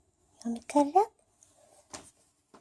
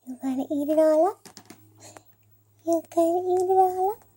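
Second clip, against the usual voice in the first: higher, fast sing-song cooing; 7 semitones higher, faster than usual. Real, not acted. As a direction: coo up at the cats quickly. Baby talk to cats.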